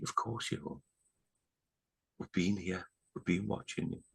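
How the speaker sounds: very soft imitation of a comforting spirit; quietly imitated tender reassurance; hushed reassurance. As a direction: voice her gently, almost whispering, with pauses. Vision woman reassures him, acted.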